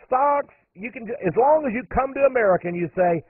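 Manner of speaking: normal talking voice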